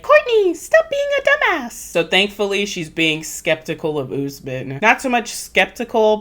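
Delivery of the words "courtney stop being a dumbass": very high-pitched tiny scolding voice; little inner voice. Acted. Voice in Courtney's head scolding her, tiny and high. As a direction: squeak it like a nagging conscience. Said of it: "a little voice in the back of my head".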